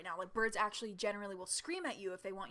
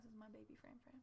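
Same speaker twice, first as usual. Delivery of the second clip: near-whispered tender cooing; 19 dB quieter, much faster than usual. Real, not acted. Holding her kitten up to viewers, softly cooing. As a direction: coo softly over the kitten.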